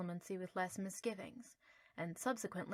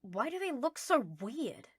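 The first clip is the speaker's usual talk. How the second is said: higher, slow, grossed-out question; disgusted; 5 semitones higher, 5 dB louder, slower than usual. Acted. Draco grossed out by the owlets. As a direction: sneer at how ugly they are.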